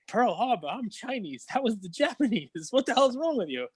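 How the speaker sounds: high-pitched comic indignation, climbing further; incredulous protest. Acted. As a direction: act baffled and affronted, pitch rising. Incredulous protest by joke character.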